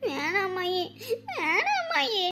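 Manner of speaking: small, very soft pleading; tearful refusal. Acted. Child begs grandmother not to hand over phone, tearful. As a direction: refuse softly, close to crying.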